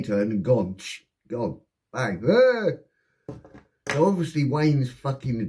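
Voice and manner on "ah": short, high, loud cry; sudden high mock yelp; imitated yell. Acting out the panicked getaway.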